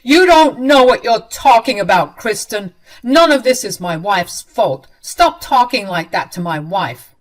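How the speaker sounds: loud, furious defence of his wife; husband snapping back at his sister-in-law, loud and angry. Acted. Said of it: "my husband angrily said to Kristen".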